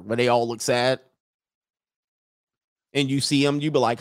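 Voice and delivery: normal talking voice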